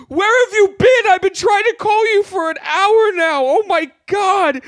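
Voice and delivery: high, loud, panicked shouting; frantic yelling. Acted. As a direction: yell it like a panicked father. Frantic dad in sketch.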